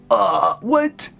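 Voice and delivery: high, exaggerated gasp of disbelief; hammed-up, high-pitched "what?"; mock shock. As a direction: blurt it like a stunning plot twist. Mock shock at plot twist.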